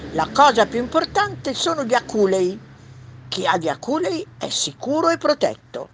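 hedgehog. Acted. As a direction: object as a hedgehog, a bit high and loud. Hedgehog voice in read story. (riccio, voce un po' acuta e forte)

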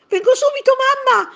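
very high, loud happy call; joyful shout. A narrator's reading. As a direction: shout back to mum full of joy. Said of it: "gridò Tonino pieno di gioia". (grido gioioso e acuto)

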